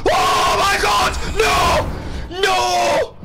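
high panicked shrieks at full volume; terrified scream. Real, not acted. Gamer shrieking at the monster, terrified scream. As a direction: scream in real terror as the monster appears.

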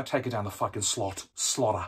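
soft, low mock Australian accent; hammed-up Australian impression; bad Australian accent. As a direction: mimic an Australian, low and quiet. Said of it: "Worst accent ever".

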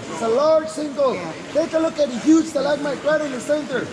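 normal talking voice

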